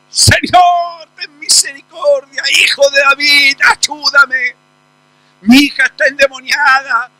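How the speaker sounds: mother shouting at the top of her lungs; shouting at full lungs. Acted. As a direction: shout it out, begging. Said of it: "gritando a pulmón".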